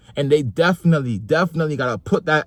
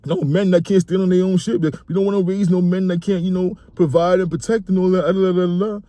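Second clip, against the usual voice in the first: mocking macho guy; 6 semitones higher than usual. Mocking hyper-masculine Twitter commenters in a hammed-up macho voice. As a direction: ham up a chest-puffing macho bro. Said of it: "these people that just swear to God".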